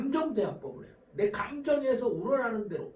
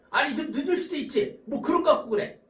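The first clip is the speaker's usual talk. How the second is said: raised, defensive retort; acted counterattack; 6 semitones higher, 5 dB louder than usual. Late spouse hitting back at the scolding, acted defensive. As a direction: fire back as the accused spouse. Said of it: "바로 반격합니다".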